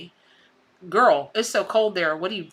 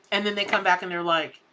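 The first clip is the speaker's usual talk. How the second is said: ordinary talk, nothing marked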